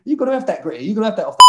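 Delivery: needling, sing-out taunt; goading teammates. Retold or imitated. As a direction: egg him on as a jeering teammate. Teammates goading Gritty in the story.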